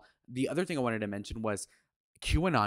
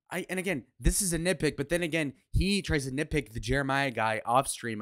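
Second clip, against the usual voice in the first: normal talking voice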